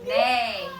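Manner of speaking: put-on a low, loud neigh for the kids; playful horse neigh. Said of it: "what sound does a horse make?".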